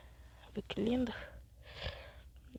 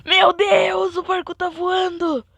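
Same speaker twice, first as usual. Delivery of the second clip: loud, high burst of disbelief; shocked shout; 8 semitones higher, 12 dB louder, slower than usual. Real, not acted. Gamer shouting at the flying boat in shock. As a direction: yell it like the boat just took off.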